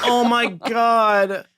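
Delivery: slow, high, laughing it out; gasping between laughs; breathless laughing. Real, not acted. Still laughing hard.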